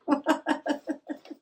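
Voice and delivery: short high chuckle; laugh. Real, not acted. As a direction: giggle at your own system. Laughs about color coded drops.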